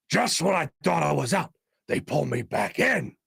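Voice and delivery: a rough, lightly done Al Pacino, mob-boss line; half-serious try at the Godfather Pacino line; Al Pacino impression. Host to chat, nailing the Pacino Godfather line. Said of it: "here's my, uh, Al Pacino".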